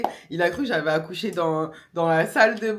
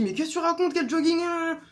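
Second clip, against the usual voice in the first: higher, exasperated mock shout; retold yelling; 9 semitones higher than usual. Partner snaps at her for asking for joggers, higher mock outburst. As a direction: imitate him snapping at her. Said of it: "Le mec, il me crie dessus".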